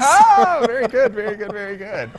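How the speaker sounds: bursting out with delight, then settling; loud, high delighted oh; excited praise. Real, not acted. Cohost praises the pirate joke.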